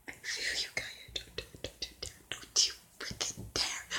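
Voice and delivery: very soft, urgent whisper; whispered plea. Pretend. Reactor pleads with Caillou not to dare, whispering. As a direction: whisper a mock warning under your breath.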